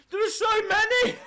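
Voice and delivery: high, loud cry of dismay; dismayed shout. Real, not acted. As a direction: yell out in despair. Dismayed at remaining food.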